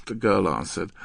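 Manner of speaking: usual speaking voice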